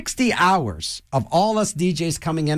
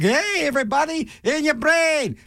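his on-air DJ shout; 9 semitones higher than usual. Retold or imitated. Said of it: "I can't be all like".

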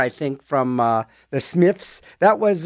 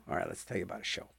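murmured aside; 11 semitones lower, 20 dB quieter, much faster than usual. Real, not acted. DJ murmurs before next segment.

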